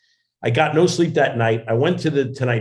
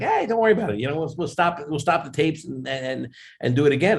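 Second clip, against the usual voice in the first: high-pitched imitation of a calming host; Jay Leno, reassuring; 5 semitones higher than usual. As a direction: soothe a nervous guest in a high voice. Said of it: "he calmed me down".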